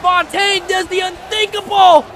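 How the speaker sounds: high, loud cries of disbelief; stunned victory shout. Real, not acted. Commentator announcing Fontaine's shock win, high excited shouting. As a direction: proclaim the upset to the whole arena.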